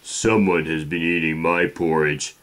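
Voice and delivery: lightly voiced Papa Bear, loud; big Papa Bear. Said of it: "cried out in his great big voice".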